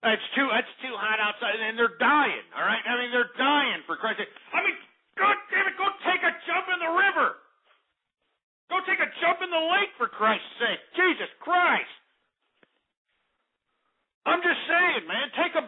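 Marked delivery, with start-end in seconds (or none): 5.1-9.9 much higher, fed-up yelp; exasperated outburst. Acted. Ranting at heat victims, exasperated and high. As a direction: throw up your hands at them.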